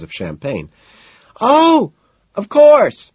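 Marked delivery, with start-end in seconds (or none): 1.4-3.1 high, loud shout over music. A narrator's reading. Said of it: "she shouts back".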